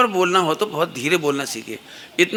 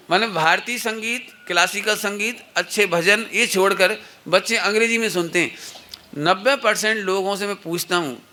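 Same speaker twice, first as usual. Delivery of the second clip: usual speaking voice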